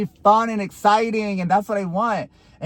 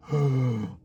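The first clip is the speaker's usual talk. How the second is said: acted sharp gasp; mimed breathless gasp; gasping for air; 4 semitones lower, 5 dB quieter than usual. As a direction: suck in air as if winded. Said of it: "gasping for fucking air".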